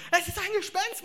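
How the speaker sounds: high, loud panicked scream of ghost; terrified cry. A narrator's reading. Disciples in the boat seeing a ghost, terrified shriek. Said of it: "schrien voller Entsetzen".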